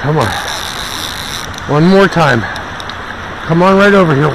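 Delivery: ordinary delivery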